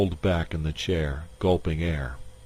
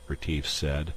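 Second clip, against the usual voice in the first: ordinary delivery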